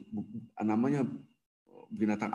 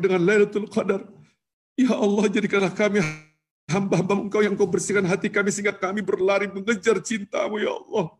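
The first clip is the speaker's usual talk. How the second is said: building prayer; 5 semitones higher, 4 dB louder than usual. Acted. Preacher praying for the congregation's forgiveness, building fervour. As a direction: swell the supplication toward the end.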